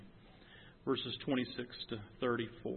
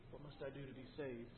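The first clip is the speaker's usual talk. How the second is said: much softer, almost to himself; hushed question; 14 dB quieter than usual. Acted. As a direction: let it fall away, hushed. Jailer's question, repeated softly.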